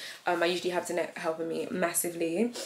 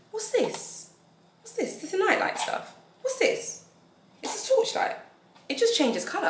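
curious; 13 semitones higher than usual. Real, not acted. Unboxing a curious gift.

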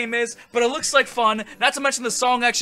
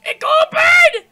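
rising frantic high yells; panicked shouting; 10 semitones higher, 8 dB louder than usual. Acted. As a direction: panic as the flame nears. Match about to burn finger.

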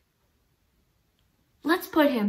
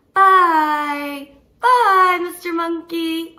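monkey goodbye; 7 dB louder, slower than usual. Acted. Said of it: "now he's gonna say bye".

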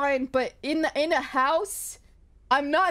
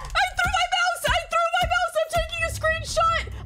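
shocked excited yelling; 13 semitones higher than usual. Real, not acted. Gamer after a jump scare, yelling that the mouse went flying. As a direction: blurt it out still shaking.

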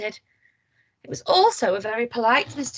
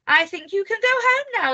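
bright dismissal; 10 semitones higher, 5 dB louder than usual. A narrator's reading. Miss Neat dismisses Miss Bossy.

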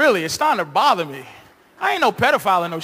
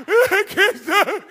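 mock sobbing; 10 semitones higher, 4 dB louder, much slower than usual. Mother crying on show.

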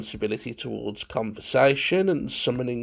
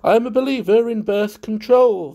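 high, loud, airy chatter; prattling guest; 9 semitones higher, 7 dB louder, faster than usual. A narrator's reading. Valentine prattling on to Munt, airy chatter. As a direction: prattle brightly, heedless of the listener. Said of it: "Valentine prattled on".

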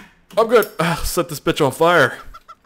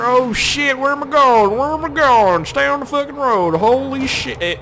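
high-pitched, rising alarm; real game panic; 12 semitones higher, 4 dB louder than usual. Real, not acted. Player losing control of the tractor, real game panic. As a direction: yelp as the vehicle careens out of control.

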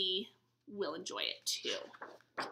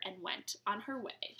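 soft, hushed line; officer leaves, whispered; whispered narration; 4 semitones lower, 10 dB quieter than usual. A narrator's reading. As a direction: let it fade to a whisper. Quiet story ending.

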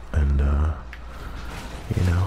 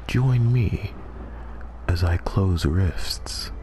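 golf commentator; 3 semitones higher, much slower than usual. Pretend. Hushed address to YouTube.

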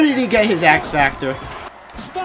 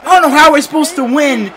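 loud, much higher disbelieving yell; real furious yell; outraged shout; 10 semitones higher, 7 dB louder than usual. Real, not acted. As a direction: scream at the screen in outrage. Defender breaks through again.

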